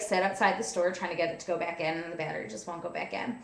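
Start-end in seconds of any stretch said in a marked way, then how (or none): none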